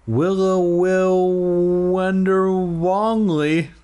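each word stretched long and sung high; drawn-out. Pretend. Reader derailed by tongue-twisting words, drawn-out and high. Plays with alliterative words mid-reading.

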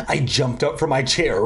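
usual speaking voice

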